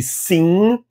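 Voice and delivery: "sim" with a nasal ending, lips never close; "sim" ends as a nasal vowel; nasal final M. Shown as a demonstration. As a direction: say "sim" through the nose, no M closure. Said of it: "no final de palavras sai pelo nariz".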